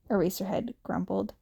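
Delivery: usual speaking voice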